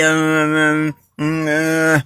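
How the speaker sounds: slow, closed-mouth droning mumble; wordless, drawn-out muffled hum; mock mumbled prayer. Shown as a demonstration. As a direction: demonstrate praying with the mouth shut. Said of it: "Let the words come out clearly".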